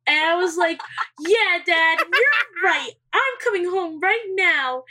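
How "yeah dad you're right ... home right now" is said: mock obedience. Fake obedient to dad.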